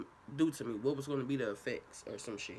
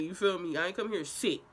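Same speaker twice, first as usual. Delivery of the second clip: ordinary talk, nothing marked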